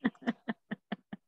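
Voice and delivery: soft chuckle trailing away; laughing. Real, not acted. Amused by the student's excuse, soft laughing. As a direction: chuckle softly in short bursts. Reaction to student's self-esteem joke.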